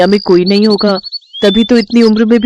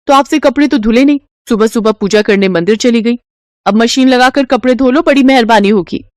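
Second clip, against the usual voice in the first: higher, sharp and sarcastic; scolding daughter-in-law; 3 semitones higher than usual. Acted. Daughter-in-law scolding her mother-in-law, higher and sarcastic. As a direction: nag and mock the old woman.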